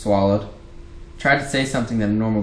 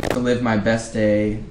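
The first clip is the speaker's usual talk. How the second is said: usual speaking voice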